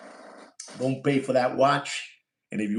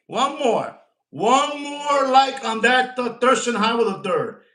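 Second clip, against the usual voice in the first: excited pleading; 9 semitones higher, 5 dB louder than usual. Real, not acted. Begging viewers for one more like.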